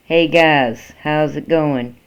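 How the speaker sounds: plain reading voice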